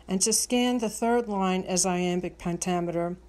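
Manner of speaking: ordinary delivery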